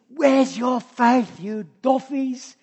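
slow, drawn-out mocking scold. Shown as a demonstration. Jesus chiding his disciples, wrong take, slow mocking scold. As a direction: rub it in slowly, chiding them. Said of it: "I don't think he said".

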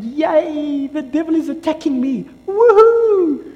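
high, loud sarcastic cheer; mock cheering. Pastor mocking joy at the devil's attack, sarcastic cheer. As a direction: fake glee at being attacked. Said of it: "Do we get excited?".

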